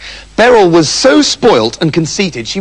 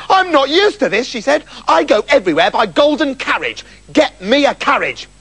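higher, haughty princess whining; complaining princess; 8 semitones higher than usual. Acted. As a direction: demand what you are used to. Princess complains while walking.

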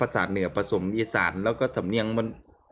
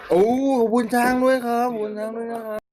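squeaky high thank-you, louder; high excited thanks; 12 semitones higher, 4 dB louder than usual. Real, not acted. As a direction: bounce the thanks up high and bright. Thanking a viewer's elephant gift. (ขอบคุณแฟนคลับด้วยเสียงสูงแหลมตื่นเต้น)

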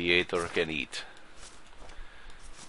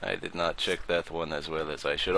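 usual speaking voice

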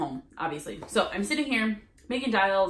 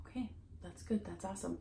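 whispered reply; 14 dB quieter, faster than usual. Retold or imitated. Hushed reply to whispering saleswoman.

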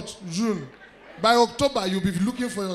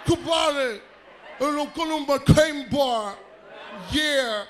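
mock returnee shouting; 3 dB quieter, slower than usual. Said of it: "you are now shouting".

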